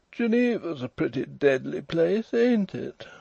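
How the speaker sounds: high, drawling, overbearing fellow; languid toff. A narrator's reading. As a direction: complain with languid superiority. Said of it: "drawling, overbearing fellow with an eyeglass".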